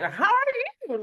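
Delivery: high, drawn-out effusive hello; gushing greeting. Retold or imitated. Showing how she greeted the players, gushing. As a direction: stretch the greeting up high and slow. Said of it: "just like".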